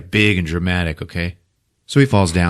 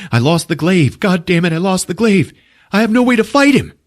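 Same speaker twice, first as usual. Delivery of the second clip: higher, rising agitation; acted-out desperate hero; frantic mock panic; 9 semitones higher than usual. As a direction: despair on the run, climbing in pitch. Hero frantic while fleeing.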